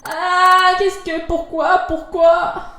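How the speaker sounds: pourquoi aigu et lent, désespéré; implore, lent et aigu; anguished. Acted. Héros désespéré. (désespoir aigu et traînant)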